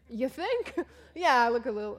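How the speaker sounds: high, loud "You think?"; sarcastic retort. Retold or imitated. Tearful traveler answering a kind stranger, sarcastic retort. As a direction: bite back with a sarcastic laugh.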